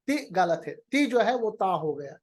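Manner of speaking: ordinary talk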